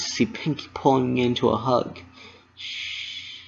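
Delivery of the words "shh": soft unvoiced hush; whisper. A narrator's reading. Pinkie hushing crying Dash in a whisper. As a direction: hush her gently. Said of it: "she whispered".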